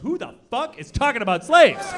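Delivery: loud, exasperated yell; angry man. Retold or imitated. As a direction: bark the question in disbelief.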